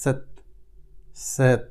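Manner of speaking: সেত with a soft স at the start, like the ছ in ছয়; সেত spoken clearly, one syllable at a time; German Z sound. Shown as a demonstration. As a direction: say সেত slowly, starting with a light স. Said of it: "এর উচ্চারণটা আসে ছয়ের মতো".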